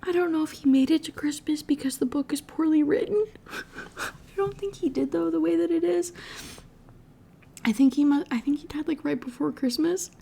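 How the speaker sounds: high, teary, breaking voice through laughter; laughing and crying at once; crying while laughing. Real, not acted. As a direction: talk through real tears and giggles. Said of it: "That is so sad".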